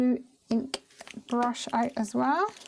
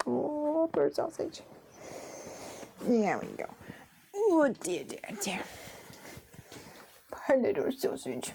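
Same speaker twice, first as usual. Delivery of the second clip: cooing sympathy; 3 semitones higher, 5 dB quieter than usual. Real, not acted. Cooing at sleepy puppy.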